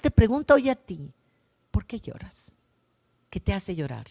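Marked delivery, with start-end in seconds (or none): 1.7-4.1 a gentle breathed question, almost unvoiced; whispered tender question; hushed whisper. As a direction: whisper it softly as Jesus asking. Jesus asks gently, voiced quote.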